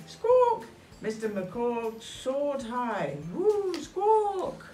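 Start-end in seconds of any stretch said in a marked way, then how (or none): 0.1-0.7 loud high bird squawk; parrot squawk. Acted. 3.3-4.6 high loud bird whoop; whooping squawk. Acted. Kite soars squawking.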